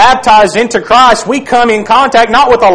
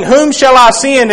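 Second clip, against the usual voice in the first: ordinary talk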